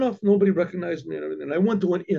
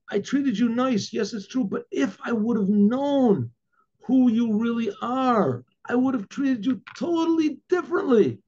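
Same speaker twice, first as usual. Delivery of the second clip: anguished regret; 7 semitones higher than usual. Retold or imitated. Innkeeper confessing to the rabbi he hosted, anguished regret. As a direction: confess it imploringly, pitch held high.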